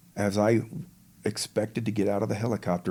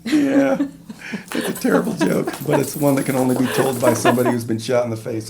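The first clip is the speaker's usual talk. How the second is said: loud, high, talking through real laughter; laughing; 5 semitones higher, 7 dB louder than usual. Real, not acted. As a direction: still cracking up at your own joke. Laughing over own terrible joke.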